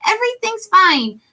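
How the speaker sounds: bright, round and cheery for Deku; Ochako voice. Shown as a demonstration. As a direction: Ochako greeting Deku, very high. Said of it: "she's very rounded".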